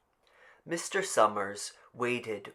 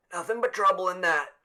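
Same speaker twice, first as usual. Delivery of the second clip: put-on stout old man; 9 semitones higher, 7 dB louder, faster than usual. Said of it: "Old Man Warner said stoutly".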